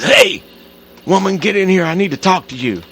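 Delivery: husband calling his wife in, angry; angry husband yelling. Retold or imitated. Ted barging in on wife.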